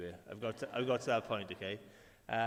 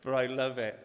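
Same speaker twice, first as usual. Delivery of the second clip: mock delight; 6 dB louder than usual. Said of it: "but he's like".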